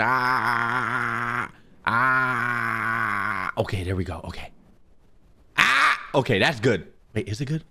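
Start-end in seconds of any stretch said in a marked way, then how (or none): 0.0-3.5 long steady loud "ah"; sustained calibration tone; mic-test ah. Shown as a demonstration. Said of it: "Microphone calibration". 5.6-6.0 short high loud "ah"; sharp test yelp into the mic; sudden high shout; test scream. Shown as a demonstration. Testing the scream threshold.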